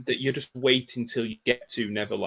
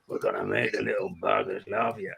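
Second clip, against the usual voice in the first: low Cockney character voice; lower, Cockney film-quote voice; Bob Hoskins as Smee; 4 semitones lower, 5 dB quieter than usual. Retold or imitated. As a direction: do a lightly growled Cockney pirate sidekick, pitched down. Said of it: "Bob Hoskins as Smee in his Cockney voice".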